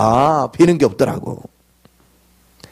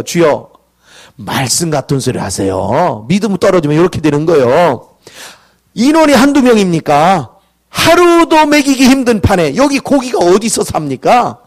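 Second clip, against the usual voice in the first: incredulous arguing with God; exasperated retort; 3 dB louder than usual. Acted. Moses' exasperated retort to God, acted.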